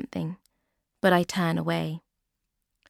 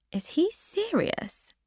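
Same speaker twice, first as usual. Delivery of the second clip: surprised high; 12 semitones higher, 3 dB quieter than usual. A narrator's reading. Becky's surprise at invitation.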